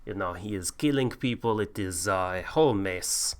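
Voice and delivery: a hint of a bad Viking; Viking accent. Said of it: "listening to your bloody accent".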